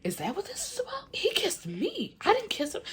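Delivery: very high, squeaky imitation of a girl protesting; high-pitched mock protest; mock indignant denial. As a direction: mimic her defensiveness way up high. Retold Caitlyn's indignant denial.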